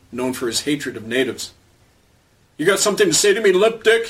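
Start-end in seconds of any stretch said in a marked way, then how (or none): 2.6-4.1 high, loud mock-scream of a bully cop; angry scream. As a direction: threaten him, towering over. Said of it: "he screamed at Frank".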